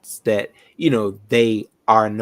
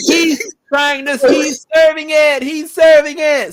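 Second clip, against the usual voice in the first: 1 yelling; 5 dB louder than usual. Real, not acted. Cheering friend on, yelling.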